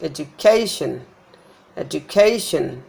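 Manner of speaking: education as edu-KAY-shun; stress before suffix. Shown as a demonstration. Teacher showing suffix rule, education stressed on CA. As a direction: lengthen and hit CA in education. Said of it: "before this syllable is stressed".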